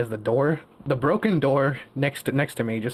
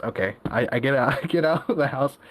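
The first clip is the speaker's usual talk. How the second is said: normal talking voice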